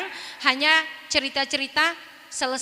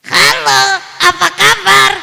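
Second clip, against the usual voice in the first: high, loud puppet voice; puppet greeting; 4 semitones higher, 12 dB louder, slower than usual. Shown as a demonstration. Ventriloquist showing the puppet greeting, high and loud. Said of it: "harus sama dengan kata-kata vokal".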